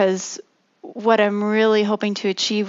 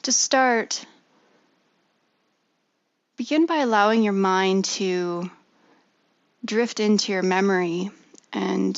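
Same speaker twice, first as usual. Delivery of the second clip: guided meditation. Acted. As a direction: ease them gently into stillness.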